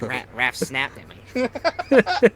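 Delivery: slow, drawn-out hurt imitation of Vince; whiny Vince impression. Friend mimicking Vince complaining about Raph, drawn out. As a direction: stretch it out like a wounded kid. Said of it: "He's like".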